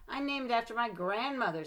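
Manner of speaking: stuck-up classmate bragging; smug girl. A narrator's reading. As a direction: boast about your name. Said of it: "said Victoria".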